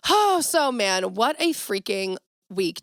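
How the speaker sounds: usual speaking voice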